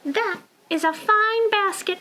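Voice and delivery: high little penguin voice. Acted. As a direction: compliment as the penguin. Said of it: "said Penguin".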